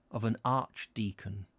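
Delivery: ordinary delivery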